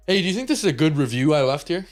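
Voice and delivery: eager dad question. Retold or imitated. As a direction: play the proud dad showing off. Dad asking about review.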